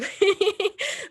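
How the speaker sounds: short high-pitched laugh; nervous coping laugh. Real, not acted. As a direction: let out a high, loud little laugh. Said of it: "I'm laughing now".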